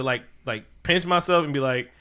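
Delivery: ordinary delivery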